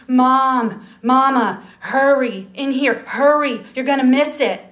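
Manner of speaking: put-on little boy hollering Mom, come see; child calling loudly. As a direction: shout for mother from the next room. Child calling mother again.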